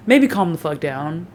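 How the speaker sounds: ordinary delivery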